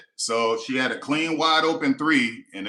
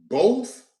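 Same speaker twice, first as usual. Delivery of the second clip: incredulous; 9 semitones higher, 6 dB louder than usual. Real, not acted. Incredulous question.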